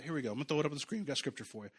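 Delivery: usual speaking voice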